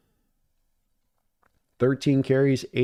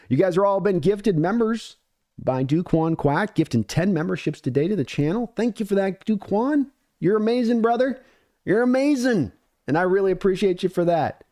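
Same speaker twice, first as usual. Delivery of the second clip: excited; 7 semitones higher than usual. Real, not acted. Hyped thanks for gifted memberships.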